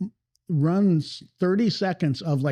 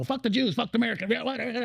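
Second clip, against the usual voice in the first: mock ranting speech; 6 semitones higher, 3 dB quieter, faster than usual. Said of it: "sounds like it's more like".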